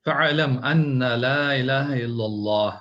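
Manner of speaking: slow, measured chanted Arabic recitation; Quranic recitation. Acted. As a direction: intone the verse slowly like tajweed. Reciting Quran verse in lesson.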